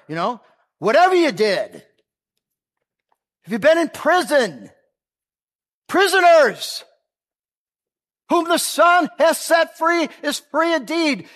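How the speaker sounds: loud, high-pitched sermon yell with stretched key words; fervent preaching. Real, not acted. Preacher yelling to the congregation and prisoners for amplification, fervent preaching. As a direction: preach it at full force, hammering each point.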